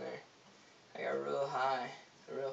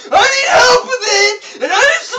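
much higher, very loud crying shout; acting crazy; 12 semitones higher, 20 dB louder than usual. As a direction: wail it like a desperate madman. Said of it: "start acting crazy".

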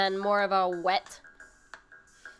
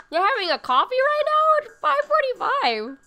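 high, louder mock disbelief at the late coffee; pitched way up, teasing amazement; surprised; 5 dB louder than usual. Real, not acted. Streamer teasing a chatter about late coffee, surprised.

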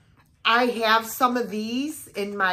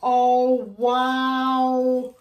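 excited; 3 semitones higher, 8 dB louder than usual. Real, not acted. Finds pull-out garlands.

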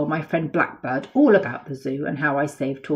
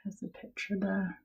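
whisper; 3 semitones higher, 12 dB quieter than usual. Real, not acted. Aside to child, whispered.

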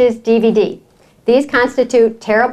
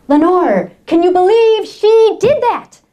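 mock outrage, higher and drawn out; livid neighbor; 4 semitones higher, slower than usual. Upset neighbor venting to her friend, livid. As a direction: imitate an appalled friend, slowly stretching the words. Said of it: "she was livid. She was so upset".

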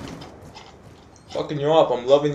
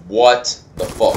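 ordinary talk, nothing marked

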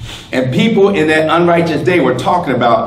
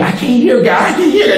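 whiny mock complaint; 8 semitones higher, faster than usual. Mocking people who can't hear.

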